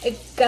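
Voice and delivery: "k, g," as bare back-of-throat sounds. Shown as a demonstration. Therapist demonstrates velar sounds for parents, isolated. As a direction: click out k then g from the throat. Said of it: "like K and G".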